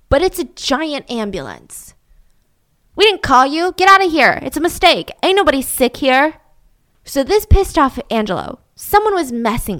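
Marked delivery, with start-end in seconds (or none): 3.0-6.4 shouting them off. Acted. Shooing off the ambulance.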